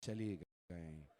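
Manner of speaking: softly into the ear, slowed right down; told into the ear. A narrator's reading. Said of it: "बाबा कान में कहा". (कान में धीरे से कहा हुआ)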